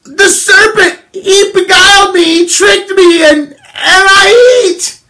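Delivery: very high sobbing plea; crying Eve. Acted. Preacher acting Eve blaming the serpent, high sobbing. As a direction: wail that the snake tricked her. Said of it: "And she said".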